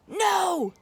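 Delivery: loud high defiant yell. Acted. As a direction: shout it stubbornly. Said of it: "yelled Ned".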